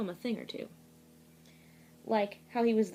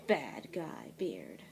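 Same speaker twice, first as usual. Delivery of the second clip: slow, much lower villain growl; sinister low voice; 9 semitones lower, 6 dB quieter, much slower than usual. A narrator's reading. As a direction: drop it low like a cartoon bad guy. Villain reveal in read-aloud.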